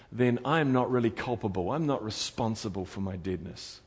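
ordinary talk, nothing marked

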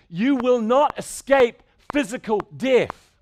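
loud, rising sermon voice, hammering every line; preaching at full force. Acted. Pastor warning his congregation they cannot escape death, preaching at full force.

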